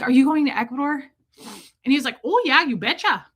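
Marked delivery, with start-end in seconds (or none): 0.0-1.0 raised, higher-pitched question to a stranger; calling out. Retold or imitated. Traveler calls to a tall stranger, calling out. 2.2-3.3 put-on higher, louder cheery Midwestern accent. Friendly stranger answers the traveler, Midwestern accent. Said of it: "people talk a certain way".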